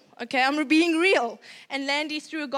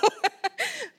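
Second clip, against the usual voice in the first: high burst of real laughter; 11 semitones higher than usual. Real, not acted. Mom laughing at her own story, real laugh.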